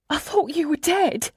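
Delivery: high, tearful disbelief; acted sobbing, pitched well up. A narrator's reading. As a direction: sob it out in a high voice. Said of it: "she sobs".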